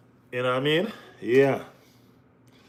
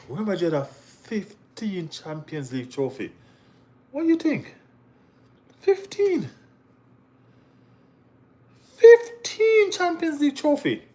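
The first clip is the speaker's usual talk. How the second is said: fifteen said way higher and slower, hammering it home; emphatic disbelief; 11 semitones higher, 3 dB louder than usual. Real, not acted. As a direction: stretch out fifteen in awe. Stressing Madrid's fifteen titles.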